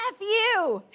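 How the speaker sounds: loud, high mock snarl; a hammed-up teen athlete snapping back; mock angry retort. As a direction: throw it back like a sulky teen. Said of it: "he goes".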